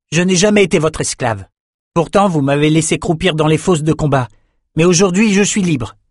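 high-pitched, impassioned declaration; defiant young fighter. A narrator's reading. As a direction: assert your freedom to former masters. Bannon declares himself free.

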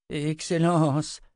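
high, drawn-out deferential woman's voice; high-voiced anxious servant; timid interjection. A narrator's reading. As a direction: dare a word to your master, pitched high. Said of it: "osa souffler Armina".

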